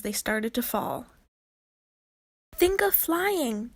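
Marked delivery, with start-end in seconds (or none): 2.5-3.7 high, slow urgent call; shouting. Acted. Tink shouting to falling girls, slow and urgent. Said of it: "Tink shouted at them".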